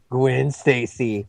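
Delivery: slow, drawn-out creepy murmur; creepy villain greeting. Retold or imitated. Host imitating a creepy guy in the elevator, slow and lingering. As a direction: linger on her name like a stalker. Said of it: "two creepy guys are going".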